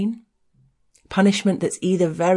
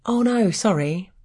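higher-pitched acted apology; a quick 'oops' apology, pitched up; apologetic; 6 semitones higher than usual. Spilling his pint on the way from the bar, apologetic.

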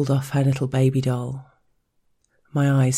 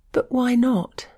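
much higher startled protest; gasping girl voice; 10 semitones higher than usual. Acted. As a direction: protest breathlessly, way up high. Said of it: "she gasped".

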